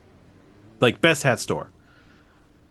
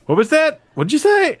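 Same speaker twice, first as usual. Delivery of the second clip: high, louder baffled asking again; confused on the phone; 4 dB louder than usual. Retold or imitated. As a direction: play someone straining to catch a thick accent. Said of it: "by constantly being like".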